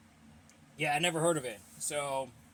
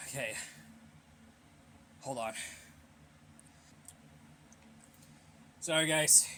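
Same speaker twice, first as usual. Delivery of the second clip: out of breath; 7 dB quieter than usual. Real, not acted. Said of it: "I'm out of breath a little bit".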